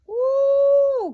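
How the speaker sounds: high, loud cheer of joy; excited whoop. Real, not acted. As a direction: let out a big happy woo. Celebrating new weight milestone.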